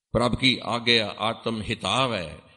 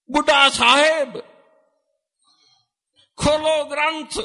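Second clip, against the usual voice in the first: high, loud proclaiming call; 9 dB louder than usual. Retold or imitated. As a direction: call out to Baba Buddha across the hall. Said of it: "ਉੱਚੀ ਆਵਾਜ਼ ਵਿੱਚ ਬੋਲੇ". (ਉੱਚੀ ਆਵਾਜ਼ ਵਿੱਚ ਸੱਦਾ)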